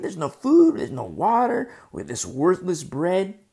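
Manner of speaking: loud, grumbling protest; murmuring complaint. Acted. Israelites complain to Moses about food and water, loud grumbling. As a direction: complain loudly, dragging out the last words. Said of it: "to murmur and complain".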